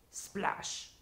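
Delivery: sharp, hissy imitated splash; splash noise. Picasso narrating his brushstrokes aloud, imitated splash. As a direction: make a splash with the mouth. Voicing the painter's strokes aloud.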